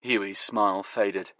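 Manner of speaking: ordinary delivery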